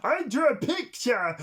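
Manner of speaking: put-on high, loud, goofy childish voice; dim drooling son. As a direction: say it like a proud dopey kid. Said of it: "he's like".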